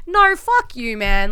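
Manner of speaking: ordinary talk, nothing marked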